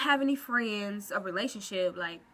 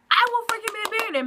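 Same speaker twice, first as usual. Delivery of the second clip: higher, louder, fast declaration; excited boast; 10 semitones higher, 5 dB louder, much faster than usual. Real, not acted. Vowing to viewers that words have power, excited. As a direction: burst out with total conviction. Emphatic claim about manifesting.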